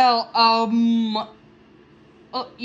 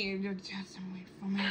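whispering; 4 semitones lower, 18 dB quieter, much faster than usual. Shown as a demonstration. Repeating phone line in a whisper.

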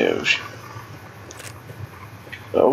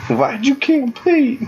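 accusing townsfolk; 11 semitones higher than usual. Pretend. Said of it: "Just waiting for the entire town to come out".

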